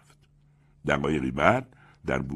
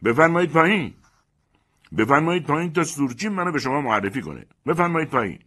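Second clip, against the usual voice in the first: high, loud furious yelling; enraged repeated shout; angry shout; 9 semitones higher, 6 dB louder, faster than usual. Acted. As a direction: bellow it again and again. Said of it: "چندین بار با خشم فریاد زد".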